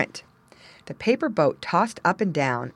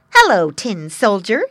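sneering imp, loud and higher; giggly sneering imp; 3 semitones higher, 10 dB louder than usual. A narrator's reading. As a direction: greet him with a nasty sneer. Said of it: "said the imp".

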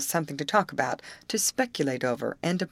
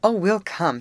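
louder, higher, assured promise; confident; 4 semitones higher, 6 dB louder than usual. A narrator's reading. Molly reassures Amy they will come, confidently. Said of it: "with much confidence".

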